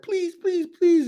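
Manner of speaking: high, mock-tearful pleading please; retold crying plea. Flight attendant begging the pilot, mild mock tearful plea. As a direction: plead like she's begging through tears, lightly. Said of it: "she basically is crying".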